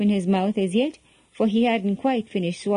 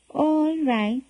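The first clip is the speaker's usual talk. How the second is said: higher-pitched meek agreement; 5 semitones higher than usual. A narrator's reading. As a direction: give in meekly, pitch lifted. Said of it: "he said meekly".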